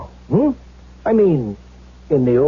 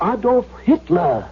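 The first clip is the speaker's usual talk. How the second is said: proud reveal; 12 semitones higher, slower than usual. Acted.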